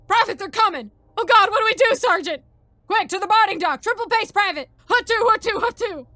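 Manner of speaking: loud, high urgent military yelling; panicked shouting. Acted. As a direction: yell orders in an emergency. Panicked sergeant and private rush.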